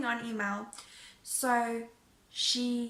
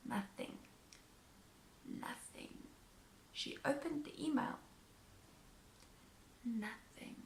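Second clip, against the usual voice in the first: whispered nothing; 16 dB quieter than usual. Real, not acted. Dramatic silence from the other side.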